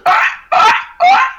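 short high warning squawks; raptor run noise. Shown as a demonstration. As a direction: bark out high, loud raptor alarm calls. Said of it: "the run noise was".

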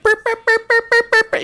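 very high, loud repeated blare; imitated blaring beat. Cohost mimics the aggressive album's noise, high blaring bursts.

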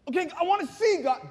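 high, loud, urgent demand; desperate plea. Acted. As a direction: burst out pleading with God. Saul pleading back to whispering God.

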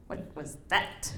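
a hushed, slow whisper, as if the moose wonders what it heard; voicing the moose's puzzled thought in a hushed, slow whisper.

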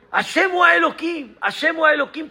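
high, ringing declaration of God's name; exalted proclamation. Acted. Preacher to congregation, a soaring proclamation. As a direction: proclaim it twice with fervour.

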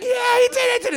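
high, loud cheer; crowd cheering. Retold or imitated. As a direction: whoop it for the hero. Everyone cheering.

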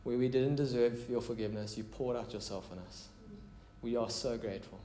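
quiet, intimate prayer leading. Real, not acted. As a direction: pray aloud gently and earnestly, voice dropping at phrase ends. Leads the congregation in prayer.

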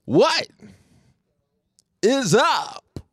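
high, drawn-out hype greeting. Acted. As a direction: stretch out a big hype hello.

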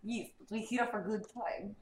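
put-on heavy Russian accent, slow; playful Russian accent. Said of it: "do it in a Russian accent".

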